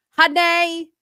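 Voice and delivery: high, loud "honey" ending in "ay"; show host's catchphrase. Retold or imitated. Co-host showing how the host says it, high-pitched imitation. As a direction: mimic her squealed, stretched "honey". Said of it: "That's how she says it".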